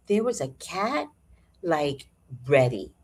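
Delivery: ordinary talk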